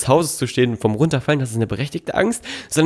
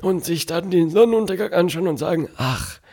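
put-on high, shaky old man voice; old grandpa voice; 7 semitones higher than usual. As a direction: play an old grandpa looking back. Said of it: "mit so einer Opa-Stimme".

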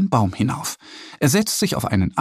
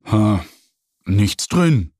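sighing; 4 semitones lower, much slower than usual. A narrator's reading. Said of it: "seufzt er".

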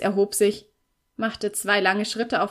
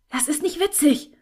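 sharp higher-pitched hiss, offended; hissed retort; 7 semitones higher, 3 dB louder than usual. A narrator's reading. Girl snapping at Felix for mocking her, hissed. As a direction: hiss it at him, stung. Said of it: "zischte ich".

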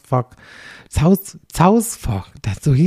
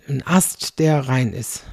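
usual speaking voice

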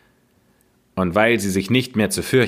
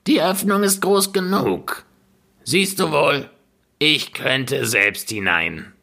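much higher, shrill witch voice; impatient witch; 6 semitones higher than usual. Acted. Witch showing Gretel the oven, high and impatient. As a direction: show off, exasperated. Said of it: "sagte die Alte".

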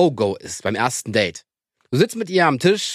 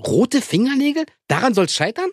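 incredulous; 6 semitones higher, slower than usual. Real, not acted. Incredulous disbelieving question.

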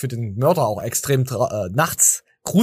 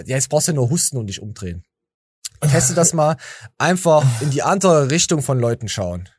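ordinary talk

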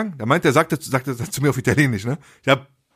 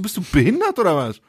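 retold indignant question; 6 semitones higher than usual. Retold quarrel with friend in car.

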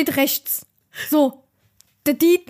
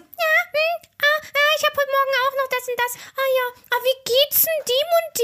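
put-on old people's small talk; 10 semitones higher than usual. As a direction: imitate chatty old folk, pitched well up. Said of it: "bei alten Leuten immer dieses".

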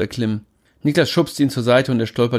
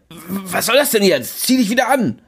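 appalled and confused; baffled outrage; 10 semitones higher than usual. Acted. Niklas baffled.

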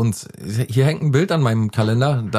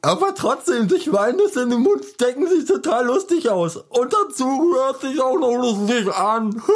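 very high, slow cartoon child voice; Infantino voice; 12 semitones higher, slower than usual. Mocking his friend, as a squeaky cartoon child. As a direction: giggle through it like a silly kid. Character voice continues.

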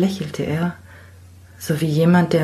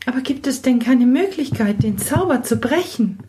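put-on high, urgent girl pleading for a way; young girl exclaiming, desperate to help; eager girl's cry; 9 semitones higher than usual. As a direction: burst out hopefully. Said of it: "dass das Mädchen ausrief".

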